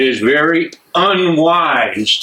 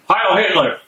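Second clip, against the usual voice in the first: a higher, forceful Heil Hitler; barked salute; 7 semitones higher than usual. Acted. Major salutes the prisoners with a barked Heil Hitler. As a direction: bark the salute like an order.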